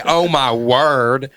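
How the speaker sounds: slow, loud Southern drawl; mocking Southern accent. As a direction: quote it back in an exaggerated Southern drawl. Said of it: "I don't ever say".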